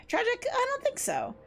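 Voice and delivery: very high, mocking NPC voice; high-pitched character voice.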